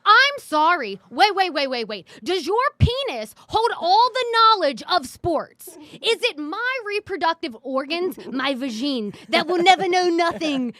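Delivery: loud, high indignant near-yell; heated rant. Real, not acted. Woman clapping back at sexist commenters, heated. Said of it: "You're starting to yell right now".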